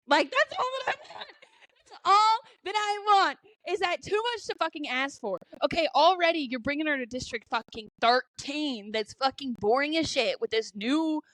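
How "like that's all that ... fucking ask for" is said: high, hammed-up whine with a laugh in it; mock pleading. Fan begging to have her favorite character back, mock pleading.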